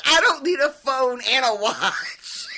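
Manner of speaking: imitated yell. Co-host re-enacting Roy's yelled comeback to Keeley, mock yelling. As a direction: yell it like his outburst, laughing through it. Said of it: "And he yells".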